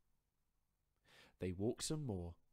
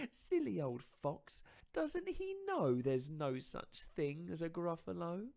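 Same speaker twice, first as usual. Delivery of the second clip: higher, louder, teasing mouse voice; smug little mouse; 10 semitones higher, 8 dB louder than usual. Acted. Mouse mocking the fled fox, higher and smug.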